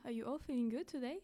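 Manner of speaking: ordinary talk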